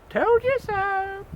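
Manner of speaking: pig voice. Acted. Pig gloating at window.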